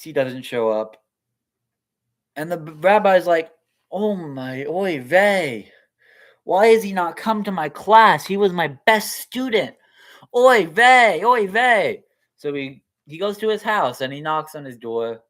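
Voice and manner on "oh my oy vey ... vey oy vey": put-on higher, louder worried old rabbi; fretting rabbi. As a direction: kvetch oy vey like a worried rebbe. Rabbi frets about missing student.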